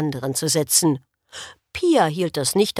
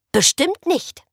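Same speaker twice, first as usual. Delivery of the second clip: indignant; 8 semitones higher, slower than usual. A narrator's reading. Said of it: "schnappte empört nach Luft".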